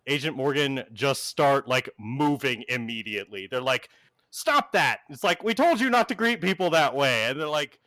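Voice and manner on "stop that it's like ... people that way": higher, louder scolding tell-off. Acted. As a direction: cut her off with an exasperated scold. GM voices agents scolding the teen.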